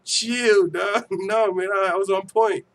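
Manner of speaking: defensive protest. Real, not acted. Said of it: "stop with the accent".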